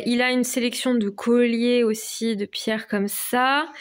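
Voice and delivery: ordinary talk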